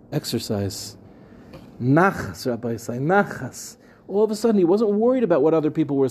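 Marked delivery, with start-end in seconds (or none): 0.1-3.8 low, soft, dead-flat answer; totally deadpan. Retold or imitated. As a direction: say "Exercise" deadpan, much lower and softer. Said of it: "with totally deadpan".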